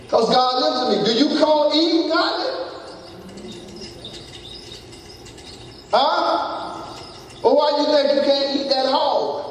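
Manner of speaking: high-pitched sermon questioning; challenging preacher. Real, not acted. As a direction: fire questions up high at the congregation. Preaches at full force.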